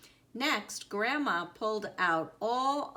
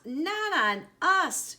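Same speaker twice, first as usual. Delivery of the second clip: high, loud, drawn-out correction; playful, stretched-out protest; amused exclamation; 9 semitones higher, 6 dB louder, slower than usual. A narrator's reading. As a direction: exclaim it high and slow, as Grandma. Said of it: "Grandma laughed".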